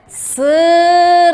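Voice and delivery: "Cerejas" drawn out slowly, in three separate held syllables. Shown as a demonstration. Teacher sounding out "Cerejas" for pupils, syllable by syllable. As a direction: stretch each syllable of "Cerejas", pausing between them. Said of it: "Agora vamos soletrar".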